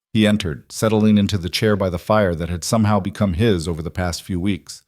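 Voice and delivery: usual speaking voice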